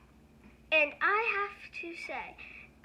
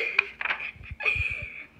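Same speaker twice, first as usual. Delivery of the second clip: giggle; 12 semitones higher than usual. Real, not acted. Giggle after Boo Boo Butt.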